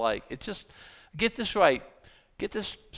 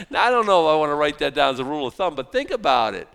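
excited aside; 8 dB louder, faster than usual. Real, not acted.

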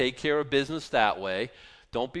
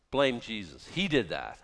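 ordinary delivery

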